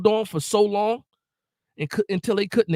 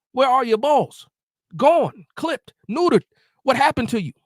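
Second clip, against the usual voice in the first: higher and louder, venting; exasperated outburst; 6 semitones higher, 4 dB louder than usual. Real, not acted. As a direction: call him out, fed up and loud. Fan ranting at the quarterback.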